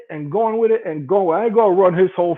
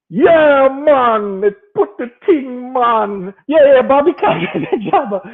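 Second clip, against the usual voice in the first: loud, high mock Jamaican patois; laughing, showy fake Jamaican accent, higher and louder; Bobby Condors in patois; 6 semitones higher, 5 dB louder, slower than usual. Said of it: "And you see the dude".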